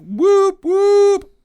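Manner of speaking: high, loud mock party cheer; party whoops. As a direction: whoop like a crowd at a rave.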